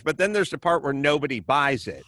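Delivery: ordinary delivery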